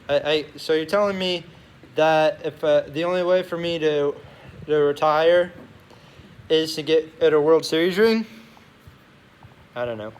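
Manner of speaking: ordinary delivery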